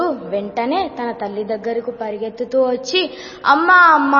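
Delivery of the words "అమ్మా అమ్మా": loud, high frightened call; scared boy yelling for his mother; shouting for mother. Acted. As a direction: cry out for mom in fear. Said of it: "గట్టిగా అరిచాడు".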